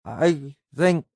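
faltering, dying words; halting and slurred, fading life; slurred, weak. Acted. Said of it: "she said in a slurred voice".

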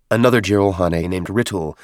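ordinary delivery

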